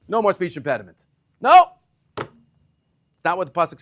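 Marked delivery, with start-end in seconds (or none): 1.4-1.7 sharp, high, loud no; emphatic no. Real, not acted. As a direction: shut the idea down. Emphatic rejection of idea.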